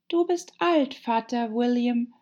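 put-on high-pitched girl reciting verse; high, measured poem recital; Alice reciting. A narrator's reading. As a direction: recite it like a schoolgirl.

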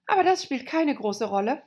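Alice thinking aloud; girl voice. A narrator's reading. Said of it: "dachte Alice".

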